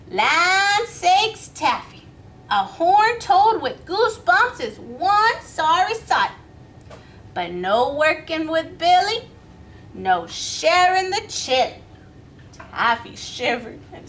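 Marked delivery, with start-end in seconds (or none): 0.1-11.8 louder, emphatic refusal; firm Miss Billy. Acted. Miss Billy refusing cold Taffy, loud and firm. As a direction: turn Taffy away firmly.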